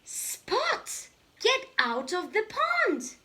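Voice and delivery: high, loud call. Acted. Dad calls to Spot to leave the pond.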